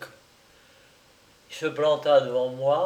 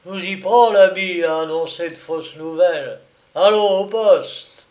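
loud, higher, stern accusation, slowing at the end; angry outcry; 4 semitones higher, 9 dB louder than usual. Acted. Big client seizes the hawker, loud angry outcry. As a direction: catch him out and march him off. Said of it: "le client s'écria".

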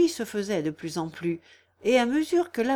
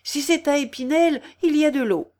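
high, loud, brisk assurance; raised, urgent voice; urgent calling out; 6 semitones higher, 6 dB louder than usual. A narrator's reading. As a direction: call over a crowd at a fire. Calling out during fire alarm.

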